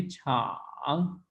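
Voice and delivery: छांग dipping down then back up; full third tone. Shown as a demonstration. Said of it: "third tone अकेली पढ़ी जाएगी".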